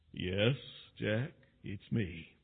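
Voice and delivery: low, slow, soft divine voice; voice of God. Acted. God answers the man on the cliff, calm and godlike. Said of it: "A booming voice came thundering".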